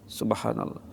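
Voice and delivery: hushed murmured subhanallah; whispered praise. Acted. Preacher murmuring praise, hushed. Soft dhikr aside.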